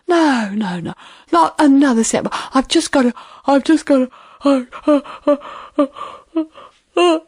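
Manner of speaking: panting, fading protest; words breaking down into tired gasps; exhausted lion. Acted. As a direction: run out of breath and give up. Exhausted lion gives up, falls asleep.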